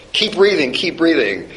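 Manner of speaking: imitating an urgent yell, high and loud at first then easing; mock urgent command to a fainted patient; urging someone to keep breathing, imitated; retold urgent yell. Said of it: "she's screaming at this person".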